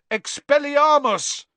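high, loud, slow spell shout. A narrator's reading. Harry held by the spider, shouting. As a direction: fling the spell with all your force. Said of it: "and shouted".

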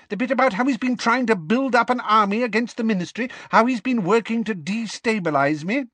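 high, fast, excited questioning; excited glee. A narrator's reading. Minister checking the notes on the plot, excited. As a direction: press on in a delighted rush. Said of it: "positively quivering with delight".

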